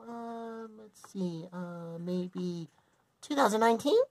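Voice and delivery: ordinary talk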